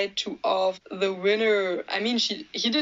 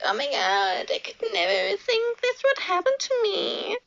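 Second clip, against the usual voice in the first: high, syrupy fake disbelief; mock humble winner; 11 semitones higher than usual. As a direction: parody a gushing pageant winner. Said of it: "She's like".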